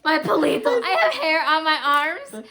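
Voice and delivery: giddy shriek over her own goosebumps; real goosebump squeal. Real, not acted. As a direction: shriek about the chills like they just hit. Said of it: "every time I get the chills".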